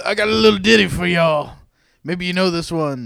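loud, hammed-up stage banter; boisterous concert host impression; mock rock star. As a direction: play the cheesy rocker to the crowd. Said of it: "He's got a li".

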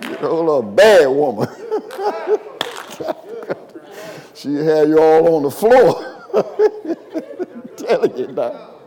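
joking through bursts of laughter; laughing preacher teasing the congregation; amused, laughing. Real, not acted. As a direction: crack yourself up between phrases.

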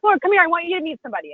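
high, eager summons; imitated eager hostess. Hostess to her to meet somebody, eager imitation.

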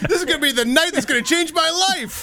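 high-pitched, laughing mock anticipation; mock excitement. Teasing co-host about going out, mock excitement. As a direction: play the over-eager partygoer for laughs. Mocking a naive partygoer's anticipation.

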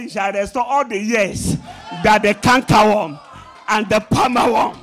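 loud, proclaiming the Lord's words; booming scripture. Acted. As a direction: thunder the promise out. Preacher quoting the Lord.

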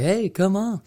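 high, eager call to a dog; excited call to the dog. Retold or imitated. Someone on the shore calling the excited dog, high and eager. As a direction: coax the dog off the boat, pitched well up. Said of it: "they're like".

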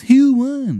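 loud, high, dragged-out Maryland drawl; over-the-top Maryland voice; Shithead Steve's Maryland accent. Acted. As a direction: announce yourself in a horrible Maryland accent. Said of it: "how bad this guy's fucking Maryland accent is".

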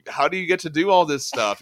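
mock-indignant older sibling; envious protest. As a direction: protest in disbelief, pitch and volume up. Retold envy of freewheeling younger brother.

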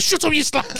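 high, sharp mock insult; mock aggressive snap. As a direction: imitate the comic's aggressive outburst. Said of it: "It's, like, so aggressive".

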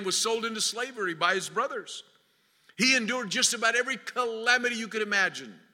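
ordinary delivery

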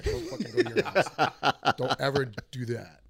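loud mock outrage, laughing around it; defensive comic rant. Comic tells off heckler's husband, mock-angry outburst. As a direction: push it out loud and indignant.

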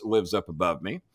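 normal talking voice

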